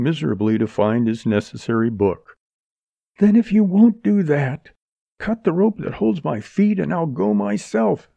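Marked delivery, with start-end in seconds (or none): 3.2-8.1 high, impassioned outcry; crying out. A narrator's reading. Captive woman demanding Freddy cut her rope, crying out. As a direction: cry it out in desperate insistence. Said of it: "she cried".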